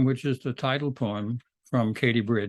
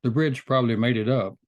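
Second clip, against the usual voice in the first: measured poem recital with pauses between phrases; poetry reading. Acted. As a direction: read your poem to an audience, phrase by phrase. Reads the title poem aloud.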